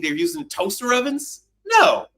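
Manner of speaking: ordinary talk, nothing marked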